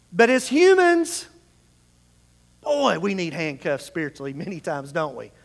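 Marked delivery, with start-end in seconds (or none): none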